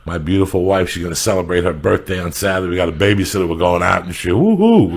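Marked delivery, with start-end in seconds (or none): 4.4-4.8 high, loud whoop; excited cheer. Real, not acted. Husband on going out for his wife's birthday, loud high cheer. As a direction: let out a celebratory whoop.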